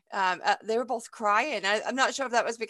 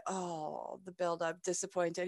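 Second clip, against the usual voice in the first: much softer, a sighing letdown; hushed mock dejection; mock disappointment; 2 semitones lower, 10 dB quieter, slower than usual. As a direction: let the air out of it, softly deflated. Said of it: "we all were like".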